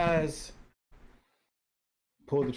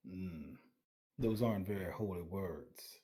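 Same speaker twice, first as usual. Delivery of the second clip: quiet; 7 semitones lower, 11 dB quieter than usual. Acted. Character coughs, replies quietly.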